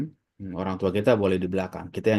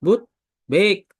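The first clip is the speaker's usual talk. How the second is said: Betawi answer; 11 semitones higher, 8 dB louder than usual. Retold or imitated. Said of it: "Betawi dipotong lamnya".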